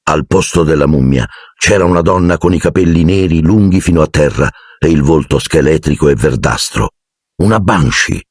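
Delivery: ordinary delivery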